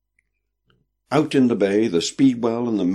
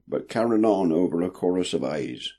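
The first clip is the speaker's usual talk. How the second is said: ordinary delivery, nothing marked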